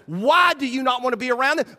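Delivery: ordinary delivery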